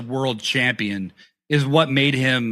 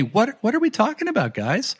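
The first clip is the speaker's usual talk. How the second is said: high, fast playing-dumb voice; mock innocence; 9 semitones higher, faster than usual. Co-host mocking a fan comment in mock innocence. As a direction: pretend you have no clue, eyebrows up.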